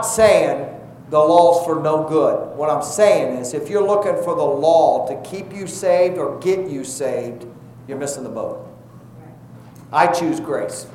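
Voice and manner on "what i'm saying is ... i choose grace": ordinary talk, nothing marked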